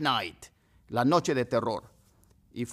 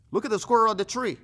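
excited pointing out; 10 semitones higher, 7 dB louder than usual. Shown as a demonstration. Teacher calling pupil to look.

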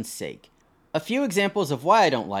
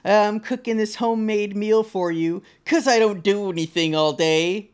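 high, louder sarcastic put-on voice; mock the mother-in-law joking back, higher; high, mocking sarcasm; sarcastic mock reply; 6 semitones higher, 4 dB louder than usual.

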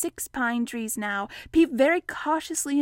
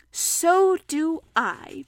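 slow, higher bear voice; Pooh; 5 semitones higher, much slower than usual. Acted. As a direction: answer very slowly and simply. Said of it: "said Pooh".

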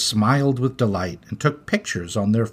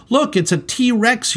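put-on high, excited child's yell; little girl yelling; 11 semitones higher than usual. Little girl spotting a T-Rex, excited high yell. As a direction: squeal it like a thrilled kid. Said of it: "yelled a little girl".

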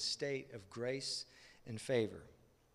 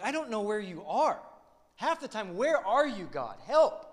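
rising to a loud, high cry for help; pleading with God, building to a shout; desperate cry; 8 semitones higher, 7 dB louder than usual. Retold or imitated. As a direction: cry out to God, louder each line. Voicing desperate prayer to God.

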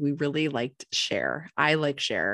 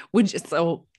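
ordinary talk

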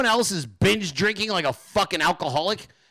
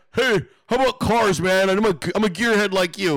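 put-on, louder chummy imitation; mock an over-eager would-be pal; mock buddy voice; 5 dB louder than usual. Brother slides into host's DMs, mocked chummy voice.

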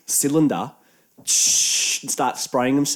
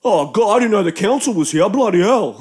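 loud, raised-pitch overreaction; mock outrage; 5 semitones higher, 4 dB louder than usual. Tent owners answering a mild complaint, loud sarcastic overreaction. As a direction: act as if it's the worst thing in the world. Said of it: "act as if it's the worst thing in the world".

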